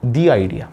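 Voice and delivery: "the" said "dee" before "idea"; "the" with a long ee, leading into "idea"; "thee" before vowel. Shown as a demonstration. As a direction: say "the idea" as "dee idea", slightly low. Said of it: "vowel sound होगा तो हम दी बोलेंगे".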